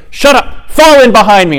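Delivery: high, loud curt order; retold commander's yell. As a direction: imitate an army commander yelling an order. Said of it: "He yelled at him".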